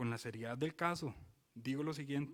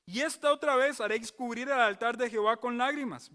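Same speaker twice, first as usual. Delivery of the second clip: solemn pulpit reading; scripture read as preaching; 6 semitones higher, 7 dB louder than usual. Acted. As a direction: declaim the prophecy to the congregation. Reading scripture at full force.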